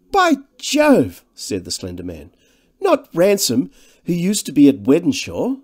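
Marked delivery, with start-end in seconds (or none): 0.1-1.2 very high, loud exclamation; astonished recognition. A narrator's reading. Slender man exclaims in sudden recognition. 2.8-5.6 high, loud incredulous question; surprised recognition. A narrator's reading. Slender man recognises old schoolmate.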